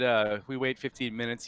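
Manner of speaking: ordinary talk, nothing marked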